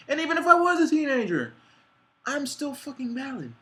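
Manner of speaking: high, loud, fast shout; defiant shout. Real, not acted. Defending her identity to doubters, a defiant shout. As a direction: belt it out in defiance.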